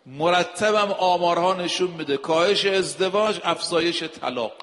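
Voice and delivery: ordinary delivery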